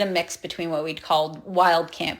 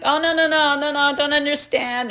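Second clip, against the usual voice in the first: high, loud, clueless tourist voice; dumb tourist act; 8 semitones higher, 3 dB louder than usual. Retold or imitated. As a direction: play dumb and pretend not to understand. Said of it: "I just played dumb tourist".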